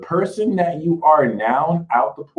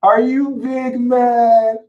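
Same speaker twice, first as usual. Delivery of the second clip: much higher, slow teasing voice; mocking baby talk; 8 semitones higher, 4 dB louder, slower than usual. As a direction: tease them like a pouting child. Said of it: "be like".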